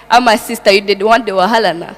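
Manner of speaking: quick Nigerian Pidgin accent. Shown as a demonstration. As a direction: speak fast in a Nigerian accent. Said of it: "change your accent to the Nigerian accent".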